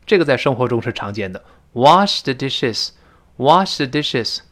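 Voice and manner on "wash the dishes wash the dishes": Wash the dishes stretched out slowly, twice; 慢慢读 Wash the dishes 两遍; slow model reading. Shown as a demonstration. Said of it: "可以把动词换成wash". (放慢示范 Wash the dishes)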